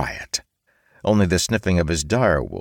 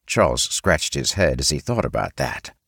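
plain reading voice

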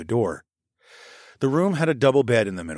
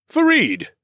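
much higher, louder call; calling a name; 6 dB louder than usual. A narrator's reading. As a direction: call him over. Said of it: "Teddy said".

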